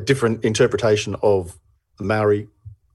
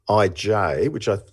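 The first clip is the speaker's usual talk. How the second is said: ordinary delivery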